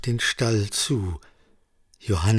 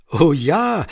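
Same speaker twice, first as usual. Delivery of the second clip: amused, higher and louder reply; cheerfully amused farmer's wife; laughing; 6 semitones higher, 6 dB louder than usual. A narrator's reading. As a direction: answer with a touch of amusement, voice lifted. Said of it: "lachte die Bäuerin".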